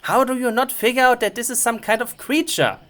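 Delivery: normal talking voice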